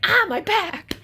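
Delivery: retold "my back" yelp; yelp of pain. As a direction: yelp as your back pops. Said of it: "and I was like".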